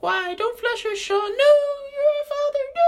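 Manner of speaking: extremely high squeal, last words drawn out; tiny pleading creatures. Acted. Little poops begging their father not to flush, high pleading wail. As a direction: wail like tiny doomed droppings. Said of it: "looking up at me like".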